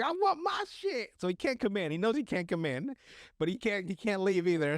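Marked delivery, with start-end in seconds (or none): 0.0-1.1 high, slow, drawn-out demand; whining tweaker. Retold or imitated. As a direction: imitate a crackhead wailing outside the door. Voices pacing tweaker demanding his things.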